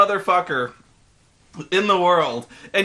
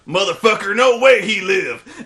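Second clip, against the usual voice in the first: hammed-up colonel growl; 3 semitones higher, 5 dB louder than usual. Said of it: "He's like".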